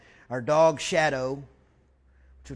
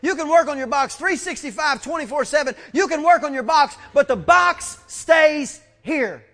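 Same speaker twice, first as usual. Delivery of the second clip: loud, high, driving sermon delivery; impassioned preacher at full volume, pitched up; full-force preaching; 9 semitones higher, 9 dB louder than usual. Real, not acted. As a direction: hammer each phrase home to the congregation.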